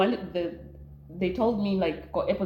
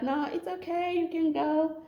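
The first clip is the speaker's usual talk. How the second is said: higher, breezy all-clear; high officer voice; 9 semitones higher than usual. Retold or imitated. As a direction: let her off lightly, pitched up. Customs officer releasing her.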